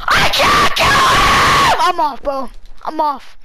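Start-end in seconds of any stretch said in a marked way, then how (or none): 0.1-1.8 high, slow furious yell; raging gamer. Real, not acted. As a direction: scream it out in rage. Stuck unable to kill enemy.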